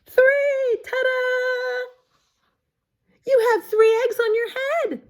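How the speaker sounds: gleeful Piggy reveal; excited reveal. Acted. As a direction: announce it like a magic trick. Piggy reveals three eggs.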